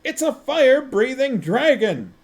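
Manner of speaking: loud high scream of frustration. A narrator's reading. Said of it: "screamed Vimes".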